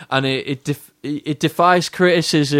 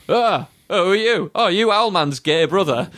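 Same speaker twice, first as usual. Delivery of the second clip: higher, taunting villain voices; mock villains; 6 semitones higher than usual. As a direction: sneer at him in a lifted sing-song. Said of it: "And they go".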